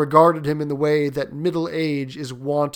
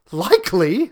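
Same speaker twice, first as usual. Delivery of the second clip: louder, higher mock dismay; pretended horror; 5 semitones higher, 7 dB louder than usual. Son jokes about throwing the paw away, mock-horrified. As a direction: feign a touch of horror. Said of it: "said Herbert with pretended horror".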